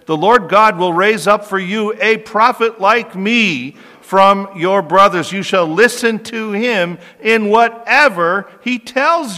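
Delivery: slow, booming scripture reading; loud proclaiming. Acted. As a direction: proclaim it slowly like a prophet. Preacher reads Moses' prophecy loudly.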